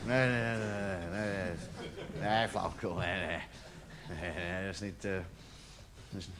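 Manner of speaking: very low, soft, repetitive muttering; muttered self-denial. Acted. Man answering his jealous inner voice, hushed denial. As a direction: brush the thought away under your breath.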